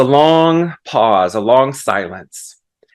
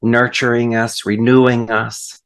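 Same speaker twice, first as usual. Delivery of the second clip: prayer; 3 semitones lower than usual. Acted. Preacher leads the congregation into thanks, prayerful and hushed at the end. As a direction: settle gently into the amen.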